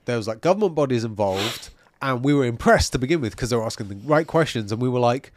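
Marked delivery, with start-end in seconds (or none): none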